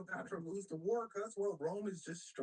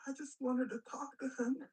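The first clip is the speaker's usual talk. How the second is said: higher-pitched mock crying voice; mock tearful; 7 semitones higher than usual. Caesar explaining himself to his best friend's brother, mock tearful. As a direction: plead as if badly faking tears. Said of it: "he breaks down into tears".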